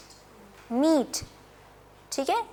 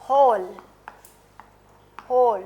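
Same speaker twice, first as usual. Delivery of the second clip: normal talking voice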